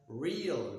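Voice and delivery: "real" as REE-uhl, with a glide after ee; double glide. Shown as a demonstration. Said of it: "the long vowel but the double glide".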